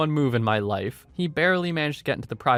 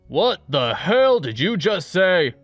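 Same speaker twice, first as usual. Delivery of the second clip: slow, loud and higher barking roar; drill sergeant shout; 6 semitones higher, 6 dB louder, slower than usual. Retold or imitated. Drill sergeant catching a trainee mouthing off, slow furious shout. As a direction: bellow it like an outraged drill sergeant.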